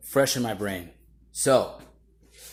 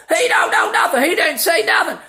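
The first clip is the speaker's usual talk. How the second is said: high, loud crowd-style hollering; full-volume mock yelling, pitched way up; 8 dB louder than usual. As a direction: mimic people shouting over a witness. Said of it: "They all start yelling".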